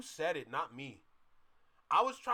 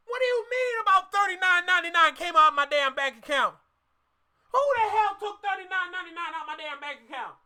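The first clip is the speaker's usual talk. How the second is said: high-pitched indignant bank-statement rant; mock outrage; 8 semitones higher, 7 dB louder than usual. Acting out a customer finding money gone from the account, mock outraged. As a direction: cry out, baffled and loud, at a mystery charge. Acts out shock at bank charge.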